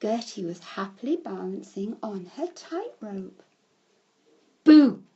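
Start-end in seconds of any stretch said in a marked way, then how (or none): 4.6-5.0 sudden loud, high shout; shouted boo. A narrator's reading. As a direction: shout it to startle someone. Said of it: "shouted Bertie".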